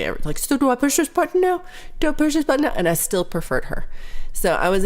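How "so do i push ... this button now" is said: put-on high, unsure new-girl voice; a light mock of a confused coworker; clueless new clerk. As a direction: mimic a lost trainee asking again. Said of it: "Just had to ask Ashley".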